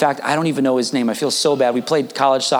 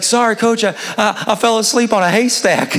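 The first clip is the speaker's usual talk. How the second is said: put-on higher-pitched, lightly Southern kid; Southern accent; 6 semitones higher than usual. Freshman explaining himself to coach, Southern accent. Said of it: "he had a Southern accent".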